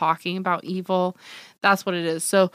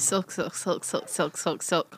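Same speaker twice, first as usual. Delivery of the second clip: soft rapid repeats of "Silk"; fast repetition; 3 semitones lower, 8 dB quieter, slower than usual. Shown as a demonstration. Answering a word-trick joke, "silk" repeated fast. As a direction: rattle off "silk" quickly, voice dropped low. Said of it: "say silk five times fast".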